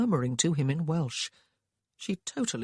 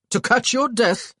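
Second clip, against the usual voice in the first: loud, high rebuke; scolding brother; gruff retort; 8 semitones higher, 8 dB louder than usual. A narrator's reading. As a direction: snap back at him.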